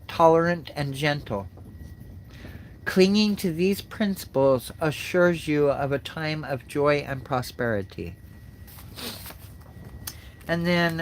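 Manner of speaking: normal talking voice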